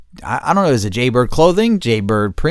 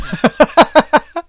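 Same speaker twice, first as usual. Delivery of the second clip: real laugh, high and loud; high cackling laugh; 8 dB louder than usual. Real, not acted. Amused at his own phone prank, high loud cackle.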